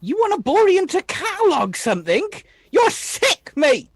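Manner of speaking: high, swooping incredulous protest; scornful outrage. Acted. Arthur answering Hawk's complaint about cataloging, scornfully outraged. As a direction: throw the complaint back in mock disgust.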